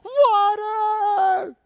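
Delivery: very high, loud triumphant yell; joyful shout. Retold or imitated. Crowd spots water in the desert, cheering loudly. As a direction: cry out like the whole crowd cheering.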